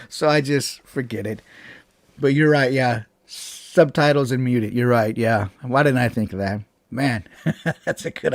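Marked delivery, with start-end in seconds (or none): none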